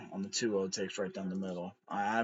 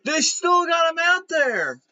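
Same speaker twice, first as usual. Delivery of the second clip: much higher, louder outraged yell; exasperated shout; 8 dB louder than usual. Real, not acted. Fan yelling at the Mets manager, exasperated shout. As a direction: scream your disbelief at the dugout.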